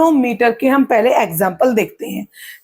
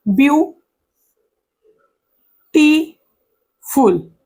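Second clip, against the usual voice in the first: "Beautiful" very slow, long gaps between view, टी, फुल; split into syllables; 4 semitones higher, much slower than usual. Shown as a demonstration. Teacher asking students to count syllables, beautiful pulled far apart. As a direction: let each of the three syllables stand alone. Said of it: "कितने syllable होंगे इसके अंदर".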